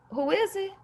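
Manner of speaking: high calling-out voice. Retold or imitated. Calling through door.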